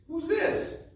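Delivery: squeaky high guessing-game question; high quiz voice. Shown as a demonstration. Teacher quizzing children in a high playful voice. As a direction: ask the kids like a playful puppet.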